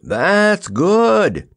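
high, stretched exclamation; big projected cheer; booming. A narrator's reading. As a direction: boom out hearty approval. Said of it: "boomed the Wizard".

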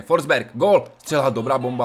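loud burst of excitement; excited shout. Real, not acted. Gamer calling his own shot to viewers, excited loud shout. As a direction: call the shot like a fan.

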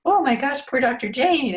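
high-pitched playful dismay; playing along, hammed-up sympathy; mock horror. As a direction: exclaim in pretend shock, voice lifted high. Mom plays along with pretend killing.

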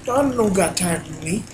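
ordinary talk, nothing marked

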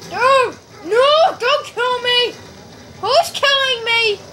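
high-pitched cries under attack; alarmed protest. Real, not acted. Being killed in the game.

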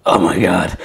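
voice going. Real, not acted. Baker's voice failing while mixing buttercream, croaky. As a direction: croak it out as the voice fails. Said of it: "My voice is going".

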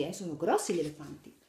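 ordinary delivery, nothing marked

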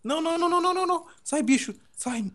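high-pitched panicked repeated cries; frantic alarm. Real, not acted. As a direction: shoo it away in a high, urgent voice. Afugentando um bicho no jogo. (não em pânico, agudo)

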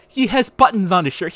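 ordinary talk, nothing marked

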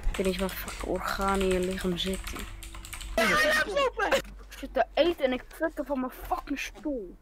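normal talking voice

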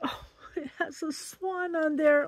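high, soft voice through real tears; crying softly over a gift; teary. Real, not acted. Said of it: "I'm emotional today".

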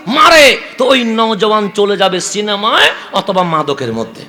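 loud, high fiery sermon cry; loud impassioned waz preaching; full-force preaching. Acted. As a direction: preach it out hard to the crowd.

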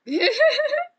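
short, high, loud laugh; high squealed giggle. Real, not acted. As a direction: burst out in a high-pitched laugh.

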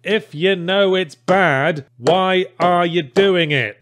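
slow, drawn-out exasperation; exasperated. Acted. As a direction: ask it in slow disbelief. Exasperated question to developers.

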